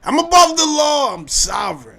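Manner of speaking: mocking a sovereign citizen. Mocking a sovereign citizen declaring himself above the law. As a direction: ham up a self-important sovereign.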